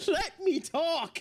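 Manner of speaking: laughing through the words, high and breathy. Real, not acted. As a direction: crack up while telling her off. Laughing at the game character.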